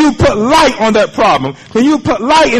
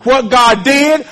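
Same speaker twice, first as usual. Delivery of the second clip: ordinary talk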